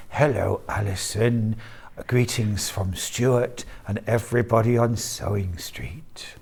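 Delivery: soft, low, whispery slug voice; snail voice. Pretend. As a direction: greet her as the tiny slug. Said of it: "in your small voice, snail voice".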